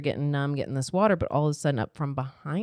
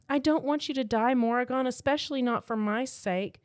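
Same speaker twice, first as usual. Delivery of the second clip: higher-pitched, earnest acted plea; pleading heroine; 8 semitones higher than usual. A narrator's reading. Rissa begging Morrigan not to die for her, pleading. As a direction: read her as pleading, voice lifted high.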